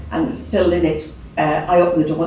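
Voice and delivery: ordinary talk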